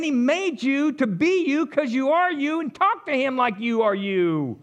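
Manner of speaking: ordinary talk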